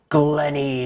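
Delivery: drawn-out spooky name. Pretend. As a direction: say it like a spooky ghoul. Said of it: "how about we be spooky and call it".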